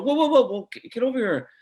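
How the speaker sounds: high, loud excited call; whoa, come back here, kid; calling him back. Retold or imitated. Actor calling a departing boy back, excited and loud.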